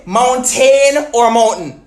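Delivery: "mountain" first as moun-TAIN, then as MOUN-tn; contrasting pronunciations. Shown as a demonstration. Challenging viewers on "mountain", slow contrast of both pronunciations. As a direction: stretch the first "mountain" slowly, reduce the second. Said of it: "How you say this word?".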